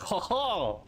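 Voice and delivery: drunken sailor mocking a French accent. Streamer shows how bad their French is now, drunken mock French. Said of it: "sound like I'm literally a drunken sailor trying to mock the French".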